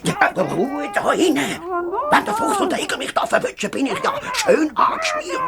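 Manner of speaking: frantic panic. Acted. Wolf alone in the house, panicking about being caught, fast and shrill. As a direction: gabble it in a squeaky fright.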